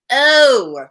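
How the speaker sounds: re-enacted realization. Reader recalls finally seeing the Scotch brand's name, dawning realization. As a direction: act out a sudden penny-drop. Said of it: "and I went".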